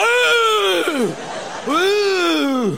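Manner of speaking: loud, high mock outburst; outraged yell. [name] bellowing at the cheeky kid, mock rage. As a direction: explode like an insulted tough guy.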